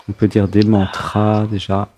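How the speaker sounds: ordinary talk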